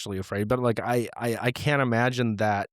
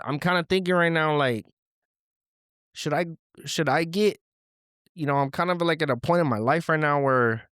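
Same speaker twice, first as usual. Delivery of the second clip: caller Junior; 3 semitones higher than usual. Acted. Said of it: "Junior, what do you have to say".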